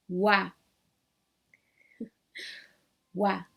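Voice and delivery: "Wa" as French oi, clear wa; wa sound. Shown as a demonstration. Teacher models French oi sound "Wa". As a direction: say "Wa" round and open. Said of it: "égale à wa".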